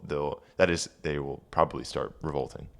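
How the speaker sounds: ordinary talk, nothing marked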